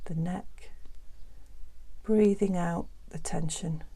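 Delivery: soft, hushed meditation voice with long pauses; guided meditation. Acted. Teacher easing listeners into breathing and letting go, guided meditation. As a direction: guide listeners gently into the body, softly and unhurriedly.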